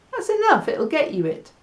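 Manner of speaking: normal talking voice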